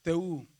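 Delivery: "Teú" con la Ú larga en arco; vocal alargada. Shown as a demonstration. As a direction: estira la Ú de "Teú", sube y baja. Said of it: "en el circunflejo se alarga". ("Teú" con la última vocal sostenida)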